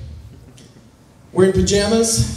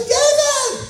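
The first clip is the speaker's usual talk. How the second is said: a squeaky wife calling out her husband's name; imitating wife. Annoyed wife calls for her husband from the bedroom. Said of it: "It's not really what she sounds like".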